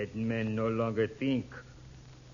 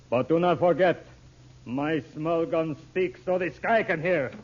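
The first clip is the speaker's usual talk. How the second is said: louder ominous threat; menacing warning; 5 semitones higher, 7 dB louder than usual. Acted. Intruder warning the whispering family, menacing. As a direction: remind them of the gun.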